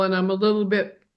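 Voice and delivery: talking through a mouthful of food; eating while speaking, words round a bite; mouth full. Real, not acted. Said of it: "With my mouth full".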